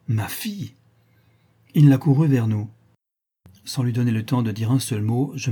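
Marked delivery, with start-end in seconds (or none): 0.1-0.7 agitated question, jumping up at the end; a touch of startled disbelief, rising sharply; agité. A narrator's reading. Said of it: "s'écria-t-il avec la plus vive agitation". (question agitée qui monte brusquement)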